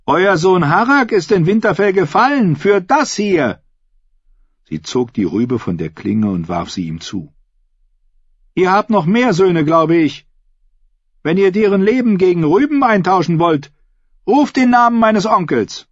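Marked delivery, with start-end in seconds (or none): 0.0-3.6 high-pitched, forceful oratory to a hall; rally the assembly in a raised, ringing pitch; defiant female claimant. Acted. Asha confronts a man. 8.6-15.9 high-pitched, forceful oratory to a hall; rally the assembly in a raised, ringing pitch; defiant female claimant. Acted. Asha confronts a man.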